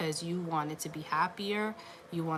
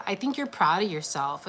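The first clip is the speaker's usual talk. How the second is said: ordinary talk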